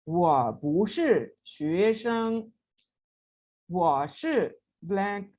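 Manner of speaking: very slow, each syllable spelled out; deliberate drill reading; slow model reading. Shown as a demonstration. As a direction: read it word by word for learners. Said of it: "वो क्या है?".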